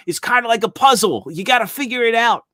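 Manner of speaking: put-on high, loud New York toy-fair kid; thick New York accent. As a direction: play it like a Brooklyn kid selling toys. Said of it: "thick New York accent".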